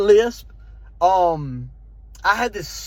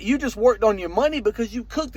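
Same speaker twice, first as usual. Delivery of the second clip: ordinary talk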